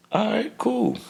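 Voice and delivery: false calm after waking; dazed high okay. Retold or imitated.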